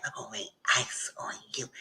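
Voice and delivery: breathy whispered threat of a spell; whispered witchy threat; whispered curse. Retold or imitated. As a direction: hiss it like a hex.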